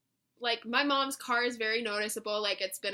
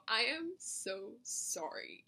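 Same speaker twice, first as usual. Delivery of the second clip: slow, softer, word-by-word apology; embarrassed apology; 8 dB quieter, much slower than usual. Retold or imitated. Customer apologizing to drive-thru girl, slow and embarrassed. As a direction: draw it out sheepishly and quietly.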